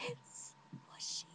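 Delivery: long drawn-out whisper; secretive whisper. Retold or imitated. Telling friend who it is, drawn-out whisper. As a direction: whisper the nickname slowly. Secretly naming boy after yelling.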